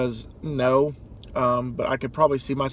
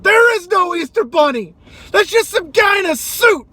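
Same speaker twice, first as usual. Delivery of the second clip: high, loud put-on tantrum yell; hammed-up raging man; mock furious shout; 8 dB louder than usual. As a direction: scream it at the kid to hurt him. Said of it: "And he goes".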